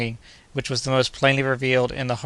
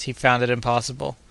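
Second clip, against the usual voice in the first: ordinary delivery, nothing marked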